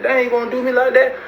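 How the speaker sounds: high-pitched imitation of indignant disbelief; retold protest. Retold inmate protesting being packed up.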